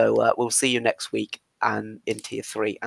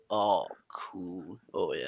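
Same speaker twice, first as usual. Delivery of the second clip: deep voice demo; 5 semitones lower, 13 dB quieter, much slower than usual. Shown as a demonstration. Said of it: "say it in the deeper voice".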